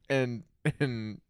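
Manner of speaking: ordinary talk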